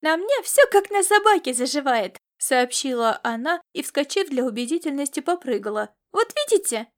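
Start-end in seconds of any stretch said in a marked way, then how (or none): 0.0-2.2 put-on high, loud childlike boast; raised, girlish bragging; boastful young girl. Girl boasts about healing fast. 6.1-6.9 put-on high-pitched childish show-off; high, pleased child; triumphant young girl. Girl proves her leg is fine.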